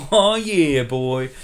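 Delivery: loud gleeful battle cry; excited whoop. Acted. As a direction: pump it up like a fan at a game. Luke igniting saber to fight.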